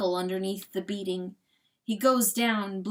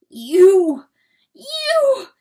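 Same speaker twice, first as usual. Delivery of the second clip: high, loud cries, second higher; speechless rage; 11 semitones higher, 14 dB louder than usual. Acted. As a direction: yell it as if lost for words. Rooster enraged, lost for words.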